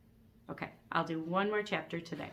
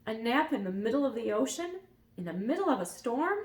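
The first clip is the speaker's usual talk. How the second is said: higher incredulous question; surprised; 5 semitones higher, 4 dB louder than usual. A narrator's reading. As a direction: ask it in disbelief. Elmer amazed.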